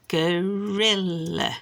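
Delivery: gorilla stretched slowly into go-ri-lla; syllable by syllable. Shown as a demonstration. Showing three syllables to a child, syllable by syllable. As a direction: draw gorilla out in three slow beats. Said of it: "Three syllables".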